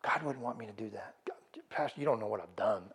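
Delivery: soft, near whisper, ashamed confession; hushed excuse. Retold or imitated. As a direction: mumble the excuse like you're hiding something. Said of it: "using their past as an excuse".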